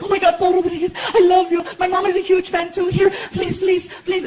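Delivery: put-on high-pitched, excited young woman's voice; gushing fan girl. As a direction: gush and plead like a starstruck fan. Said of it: "this girl jumped into the car, and she's like".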